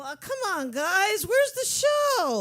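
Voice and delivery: high, rising whiny complaint; mock impatient whine. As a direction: play an impatient crowd wanting the show. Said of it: "everybody gets a little".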